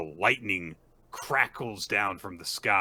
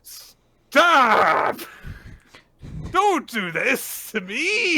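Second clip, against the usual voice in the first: much higher, loud and crying, dragged out slowly; Stop! wailed high and crying, louder than speech; 10 dB louder, much slower than usual. Real, not acted. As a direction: beg the story to stop hurting you. Overwhelmed by the touching scene.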